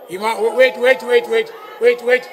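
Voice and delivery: ordinary delivery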